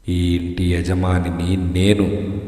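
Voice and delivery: low, slow ghost voice; ghostly claim. A narrator's reading. The ghost rises from the sofa claiming ownership, low and slow. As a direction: state it slowly from deep down, like a ghost.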